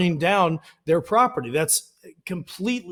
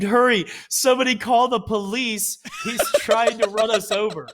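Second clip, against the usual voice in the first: higher, mocking frantic plea; hammed-up panic, pitched up; mock panicked scream; 5 semitones higher than usual. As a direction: lampoon a scared protester calling for police. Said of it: "they screamed".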